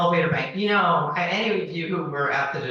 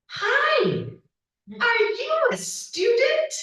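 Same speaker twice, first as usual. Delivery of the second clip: much higher, slow put-on voice for the stranger; imitating the woman; slower than usual. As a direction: pitch way up and drag it out like the nosy seatmate. Woman on the train, retold quote.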